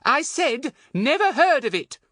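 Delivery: loud, high, emphatic repeat; irritated insistence. A narrator's reading. Thin woman repeating herself to a foreigner, irritated.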